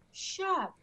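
high, sharp retold shout of shut up; retold self-scolding, pitched high; shouting at herself. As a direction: snap at yourself in disbelief. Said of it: "I even said out loud".

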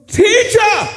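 high panicked shout of Teacher!; disciples crying out. Acted. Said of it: "And they woke him and said".

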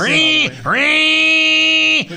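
high, loud sung ring ring like a telephone; mimicked phone ring. Host mimicking the studio phone ringing, high and loud.

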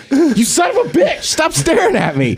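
high-pitched indignant outburst at a staring dog; hammed-up angry protest; mock outrage.

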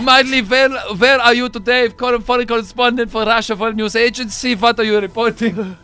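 Russian news host. Pretend. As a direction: quiz the correspondent in Russian accent. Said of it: "pretend you're a Russian news agency".

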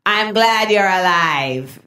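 slow, flat-toned deadpan delivery; deadpan mom. Retold or imitated. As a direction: drag it out, dry and unbothered.

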